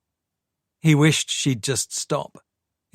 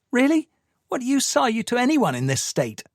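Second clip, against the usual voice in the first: much higher, sharp disbelief; incredulous anger; 13 semitones higher than usual. Acted. Sadie scolding Michael, high incredulous anger. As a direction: scoff at him, pitch leaping up.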